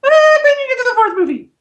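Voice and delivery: very high, quick squeal; squeaky falsetto, rushed and comically high; voice up really high. Shown as a demonstration. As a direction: exaggerate the voice shooting way up high. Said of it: "my voice goes up really high".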